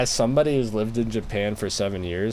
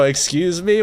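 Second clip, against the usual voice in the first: loud excuse me; 7 semitones higher, 4 dB louder than usual. Pretend. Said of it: "really loud in every conversation".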